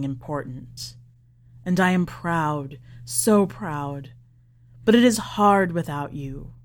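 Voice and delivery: put-on low, tender old father speaking to his daughter; old father's message to his faraway daughter, tender and low; father's farewell message.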